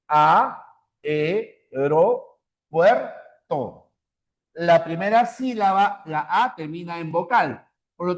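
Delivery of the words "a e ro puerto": A, e, ro, puerto said as separate syllables; split into syllables. Shown as a demonstration. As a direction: count each syllable out. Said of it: "Fíjense acá cuántas sílabas tenemos". (separado en sílabas)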